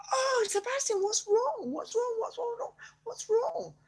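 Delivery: high, urgent anxious questions; worried pleading. Pretend. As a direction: fret over the silent dragon. Worried questions to mute dragon.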